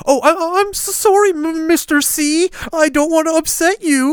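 high, loud, stammering grovel; mock a cowering friend with a whiny stammered apology; mocking grovelling apology. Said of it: "That's what you sound like".

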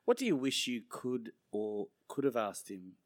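normal talking voice

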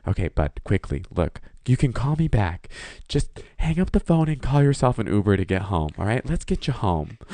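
steady a panicking friend; calm friend. Retold or imitated. Roommate voiced instructing.

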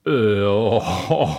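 flustered sounds of amazement; stunned gasp. Acted. Knud stunned by the lasagne prize, startled.

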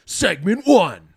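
slow, loud announcing voice; drawn-out announcement. Pretend. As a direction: stretch it out like a host. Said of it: "I'm gonna do it in a crazy voice".

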